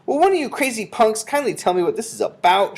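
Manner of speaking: high, loud indignant demand; boy's shout. A narrator's reading. Bruno demands an explanation from witches, shouting. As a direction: yell it as a fed-up boy. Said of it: "shouted Bruno".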